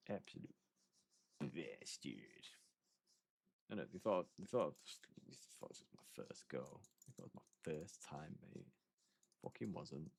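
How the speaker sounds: barely voiced, muttered under the breath; low mutter. Real, not acted. Muttering to himself after being killed in the game. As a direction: grumble it to yourself, almost whispering.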